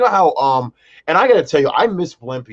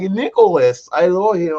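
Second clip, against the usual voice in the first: put-on British accent; 5 semitones higher than usual. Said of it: "United Kingdom accent".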